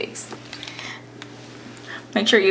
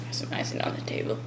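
quick whisper; 14 dB quieter, faster than usual. Real, not acted. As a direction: whisper it fast aside. Whispered aside.

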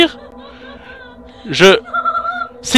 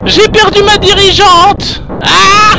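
panicked shout; 7 semitones higher than usual. Real, not acted. Streamer caught by the monster, panicked shouting. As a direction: scream as it catches you.

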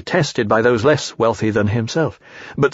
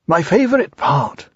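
Pooh voice; 6 semitones higher than usual. Acted.

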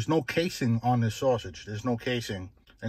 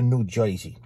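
"Jersey" as "Joisey", Bronx style; Bronx-accented "New Joisey"; 2 semitones lower than usual. Shown as a demonstration. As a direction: imitate old Bronx speech, "oy" for the "er" in "Jersey". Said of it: "they would say".

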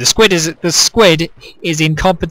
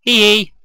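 evil giggle; 6 semitones higher, 5 dB louder than usual. Pretend. Villain giggle after trapping.

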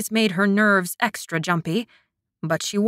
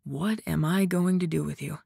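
soft murmur; mumbling; 3 semitones lower, 5 dB quieter than usual. A narrator's reading. Man mumbling to the woman settled against him. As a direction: murmur it close by. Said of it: "he mumbled".

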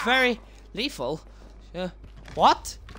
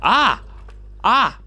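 startled scream; 5 semitones higher, 5 dB louder than usual. Real, not acted. Startled by a jumpscare.

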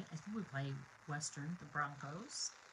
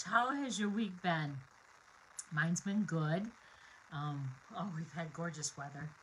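usual speaking voice

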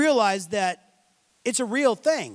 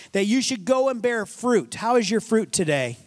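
ordinary talk, nothing marked

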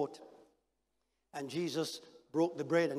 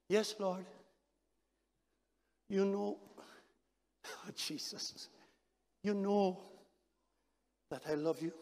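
soft guilty reply; 8 dB quieter than usual. Acted. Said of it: "softly, full of guilt and embarrassment".

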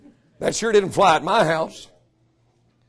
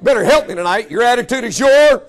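louder preaching shout; 2 semitones higher, 6 dB louder than usual. Real, not acted. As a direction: push it out to the crowd.

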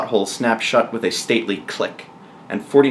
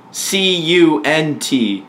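letter by letter, higher than usual; spelled out slowly; 6 semitones higher, much slower than usual. Acted. Daniel answering the queen's question, spelling slowly. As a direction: spell it out very deliberately, drawing out each letter.

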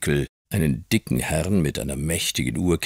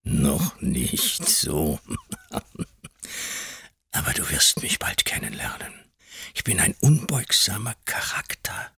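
whispering; 4 dB quieter than usual. A narrator's reading. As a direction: draw it out as a gloating whisper. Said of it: "flüsterte Mister Creakle wieder".

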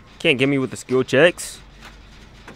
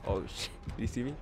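softer, lower worried hush; 5 semitones lower, 15 dB quieter, faster than usual. Real, not acted. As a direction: hush so he won't hear. Hiding from killer.

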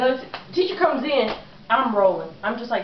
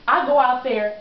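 usual speaking voice

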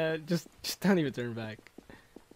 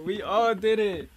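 normal talking voice

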